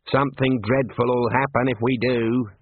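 ordinary delivery

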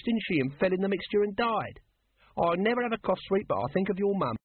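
high-pitched, needling guardsman voice; cheeky high character voice; needling Nobby. Acted. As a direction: taunt an old acquaintance, pitched well up.

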